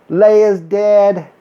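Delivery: normal talking voice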